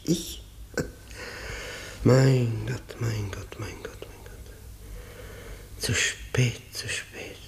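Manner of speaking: whispered, fading to almost nothing; despairing whisper. Acted. Broken man whispering his despair before the prosecutor. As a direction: breathe it out, crushed by guilt.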